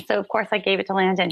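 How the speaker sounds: normal talking voice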